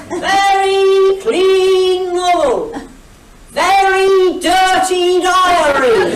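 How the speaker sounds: loud, high, very drawn-out hawker's cry; two-part market-trader yell, each word stretched; yelled sales patter. Retold or imitated. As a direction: holler it in sing-song to passers-by. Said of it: "my patter changed".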